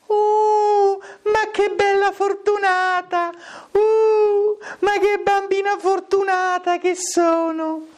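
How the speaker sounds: put-on very high falsetto girl; high, drawn-out, mock-blissful cooing. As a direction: hoot it out in a long falsetto wail.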